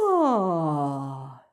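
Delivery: loud yawn sliding from high to low; a big yawning ah from the top of the voice to the bottom; yawning sweep. Shown as a demonstration. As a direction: open wide and yawn down your range. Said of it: "start high on your voice and go low".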